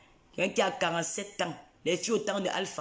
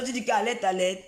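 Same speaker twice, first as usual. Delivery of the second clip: usual speaking voice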